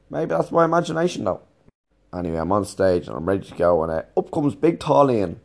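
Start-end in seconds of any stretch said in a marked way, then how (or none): none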